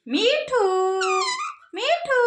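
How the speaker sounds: high singsong name-call; coaxing a parrot. Shown as a demonstration. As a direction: sing the name out high for a bird to copy. Models name for parrot to repeat. (तोते को ऊँचे सुर में पुकारना)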